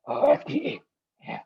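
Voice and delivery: ordinary talk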